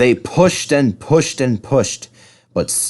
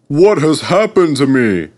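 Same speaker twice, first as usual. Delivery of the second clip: alarmed; 5 semitones higher, 6 dB louder than usual. Acted. Gordon alarmed.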